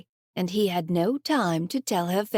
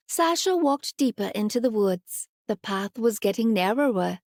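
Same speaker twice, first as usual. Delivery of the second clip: plain reading voice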